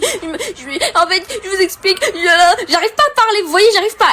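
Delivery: high, broken crying voice; sobbing, can't speak. Retold or imitated. On the phone to the hotline, crying too hard to speak. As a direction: choke out words through sobs. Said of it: "j'étais en train de pleurer énormément".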